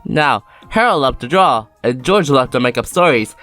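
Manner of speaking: usual speaking voice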